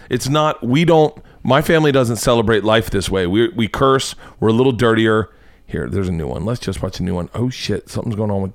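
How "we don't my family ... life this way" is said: ordinary delivery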